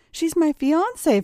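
high-pitched, exasperated objection; indignant protest. Acted. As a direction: protest urgently, pitch leaping up. Acted protest against blackmail threat.

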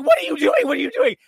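high, loud frantic demand; frantic shaking. Retold or imitated. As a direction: shout it while shaking someone.

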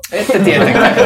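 mocking repeat with a laugh; laughing mockery. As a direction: scoff it back while laughing. NPC mocks, laughing. (nauraen pilkaten)

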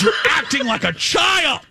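high-pitched scolding yell; imitated screaming. Retelling her phone call to him, imitated screaming. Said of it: "screaming at the top of her lungs".

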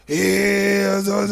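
lazy stand-up comic impression; mock hack comic. Imitating hack comic delivery.

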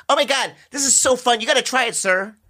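put-on high, eager kid voice; high giddy kid urging a man; excited kid. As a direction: sell the fun like a giddy child.

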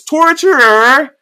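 long, high, loud drawn-out call; king summoning the torturer with a long shout; bellowed summons. Retold or imitated. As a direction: bellow the title across the chamber, slowly. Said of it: "And he goes".

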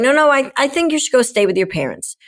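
high-pitched insistent urging; imitating a friend insisting. Retells friend's insistent advice.

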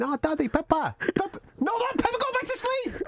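high little-girl imitation of a sleepy toddler. Dad imitating his toddler asking for Peppa. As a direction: squeak it like his two-year-old. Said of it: "She's like going".

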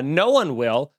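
ordinary delivery

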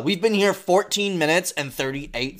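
rude customer. Pretend. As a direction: yell at the waiter.

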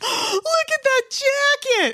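high, slow, thrilled exclamation; excited gasp. Retold or imitated. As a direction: gasp it out in delight.

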